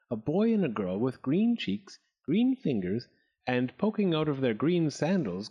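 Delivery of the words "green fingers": plain reading voice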